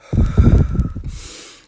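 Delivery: loud breathy gasp; heavy exhausted sigh. Retold or imitated. Winded hiker sighing loudly mid-conversation with her date. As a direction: let the air out, spent. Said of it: "feel my breath leaving my body".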